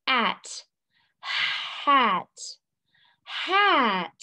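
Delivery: breath for H, pause, "at", then "hat"; drawn-out phonics blending of "hat"; sounding out. Shown as a demonstration. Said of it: "let's read this word".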